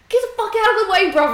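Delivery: high, loud, fast shouted insult at a driver; mock road rage. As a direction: yell it at the car ahead. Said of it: "I'll be like".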